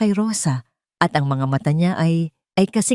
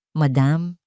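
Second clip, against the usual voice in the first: forced politeness. A narrator's reading. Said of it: "panimula niya, pinipilit na maging magalang".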